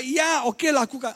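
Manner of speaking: put-on child obeying a father, high and eager; obedient child. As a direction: answer dad at once, eager. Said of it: "Papa bilang apa".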